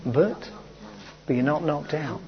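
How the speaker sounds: usual speaking voice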